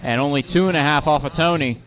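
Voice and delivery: live race call, quick and lively; motorsport commentator. Real, not acted.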